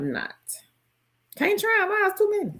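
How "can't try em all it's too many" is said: high-pitched. Real, not acted. Playful exasperation at the question.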